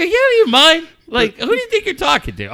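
high, loud indignant retort; mock scolding. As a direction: play up the offended dad. Retold telling-off of his son.

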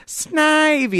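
high, loud creature squeal of its name; imitated Pokemon cry; Snivy. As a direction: cry out like a cartoon monster. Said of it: "Snivy goes".

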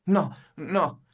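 put-on a touch of a troubled boy's cry; mild flustered cry. A narrator's reading. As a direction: blurt it out, a bit upset. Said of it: "gridò il ragazzetto turbandosi leggermente". (ragazzo un po' turbato grida lievemente)

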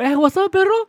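high, loud friendly call; mock cheery greeting. Greeting a buddy walking by, high and loud. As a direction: sing out a hello to your boy.

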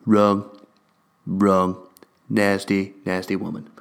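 low mock Trump "wrong"; Trump impression. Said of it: "amazing man Donald Trump would say".

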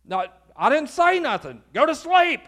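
rising to a high, loud order; exasperated Eli. Acted. Eli woken a third time by Samuel, exasperated yell. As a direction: deny it, then yell the command.

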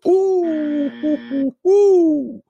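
high, loud hooting ooh; falsetto hoots. Real, not acted. Host teasing the audience with high hoots. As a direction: hoot it up high like a crowd teaser.

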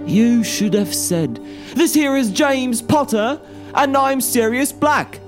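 high, breezy youth; light-voiced cheeky lad; cheeky introduction. A narrator's reading. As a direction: introduce yourselves with mock politeness. Cheeky youth introduces himself to police.

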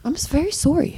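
put-on high-pitched apologetic Canadian accent; mock Canadian accent. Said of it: "Sorry, Canadians".

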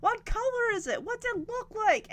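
high, wide-eyed astonishment, bursting out loud; amazed excitement. Retold or imitated. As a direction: imitate an astonished little sister. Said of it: "Stephanie's eyes bug out of her".

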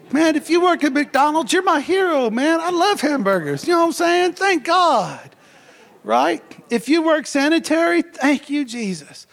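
high, loud exuberant preaching; preaching at full force. Real, not acted. Preacher praising McDonald's and sanitation workers, full-force preaching. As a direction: preach it big to honour every worker.

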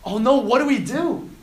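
high, loud mock panic; hammed-up alarm, pitch jumping up; freaking out. Servant surrounded by an army, freaking out. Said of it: "servant starts freaking out".

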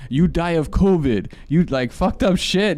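slow, loud imitation Indian accent. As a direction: mimic an abusive seller in a rough Indian accent. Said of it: "like, with an Indian accent".

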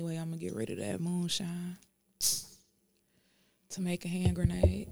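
unvoiced whisper, half to herself; whispered aside. Real, not acted. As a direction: slip it in as a secret whisper.

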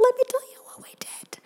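breathy conspiratorial whisper. Acted. Vlogger leaning in to reveal the spending, whispering. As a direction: lean in and whisper the secret.

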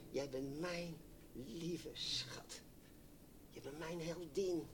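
slow, whispered words of love from God; tender whisper. Acted. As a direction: breathe it like a secret to a loved one. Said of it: "en dan zegt hij". (langzaam fluisterend, teder)

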